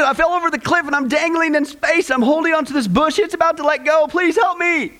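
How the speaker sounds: high, hammed-up panicky plea; acted frantic pleading. Tourist dangling from a bush begs for help, acted panic. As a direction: pour it out high, as if losing your grip. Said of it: "he pleads, he says".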